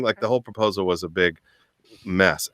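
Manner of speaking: normal talking voice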